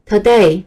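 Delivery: light "to", then hit "day" in Today; teacher's model Today, second syllable stressed. Shown as a demonstration. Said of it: "第二个音节要发重音".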